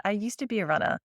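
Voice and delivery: usual speaking voice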